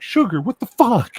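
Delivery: high, slow, loud disbelief; mock shocked cry at a friend; shocked yell. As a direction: yell his name in disbelief. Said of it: "And we'd be like".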